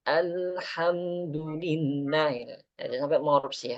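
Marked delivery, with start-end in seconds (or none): 0.1-2.3 alhamdulillah slow and even; slow recitation. Shown as a demonstration. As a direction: say it gently and slowly. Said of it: "pelan-pelan aja".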